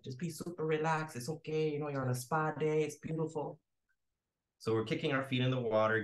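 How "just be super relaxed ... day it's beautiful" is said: higher-pitched director coaxing his actors to relax; put-on soothing director voice; Fernando. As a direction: imitate a reassuring director in a raised voice. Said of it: "Fernando, the director, is like".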